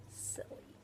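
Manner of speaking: breathy whispered aside; just whisper it; tiny whispered comment. Real, not acted. Whispered aside while reading.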